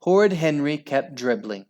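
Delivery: plain reading voice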